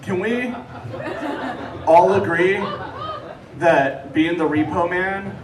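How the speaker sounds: usual speaking voice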